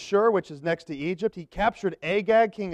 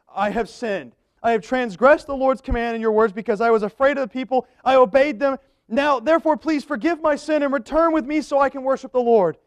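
pleading Saul; 6 semitones higher, 4 dB louder than usual. Acted. Saul begs forgiveness.

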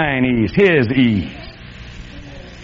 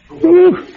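high, loud mock whistle of fright; mock whew; 12 semitones higher, 3 dB louder than usual. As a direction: let out a startled whew. Scared of the dog sign.

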